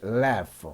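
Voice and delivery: "laugh" ending in a clear F sound. Shown as a demonstration. Teacher shows learners the GH rule, "laugh" ending in F. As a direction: model "laugh" with GH pronounced F. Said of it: "지에이치도 F 발음이 납니다".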